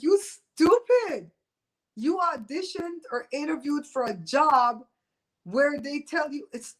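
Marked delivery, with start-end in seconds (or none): 0.0-1.3 high, loud, slow sneer; mocking the speaker of the House; scornful jab. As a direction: stretch out the insult in disbelief. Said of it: "I'm like".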